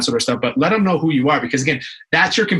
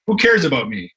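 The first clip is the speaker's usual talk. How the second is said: ordinary talk, nothing marked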